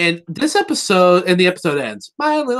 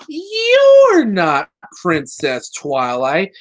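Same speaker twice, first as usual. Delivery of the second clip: mock disbelief; 3 semitones higher, 4 dB louder, much slower than usual. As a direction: stretch it out in hammed-up suspicion. Retold disbelief at the impostor.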